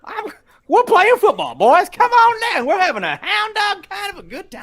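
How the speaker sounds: very high, loud hillbilly mascot; Cletus the robot. Acted. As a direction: whoop it up like a cartoon cowboy. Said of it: "Cletus the robot".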